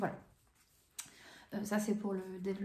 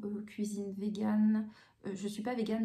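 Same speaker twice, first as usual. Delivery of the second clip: normal talking voice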